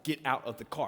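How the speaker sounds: low, level imitation of an officer; firm police order. Officers ordering the driver out, firm and low.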